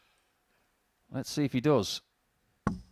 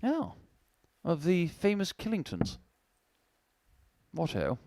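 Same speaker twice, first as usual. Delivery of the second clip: puffed cheeks mocking; hammed-up toff, light; posh voice; 3 semitones higher, 4 dB quieter than usual. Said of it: "in a posh voice".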